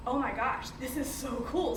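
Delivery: ordinary talk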